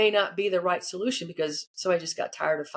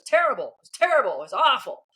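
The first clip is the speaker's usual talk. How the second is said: emphatic, high-swooping exclamations, louder on each terrible; imitated dismay; 5 semitones higher, 4 dB louder than usual. CEO telling his coach how breakfast went, imitated emphatic dismay. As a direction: lament it emphatically as someone else's disaster.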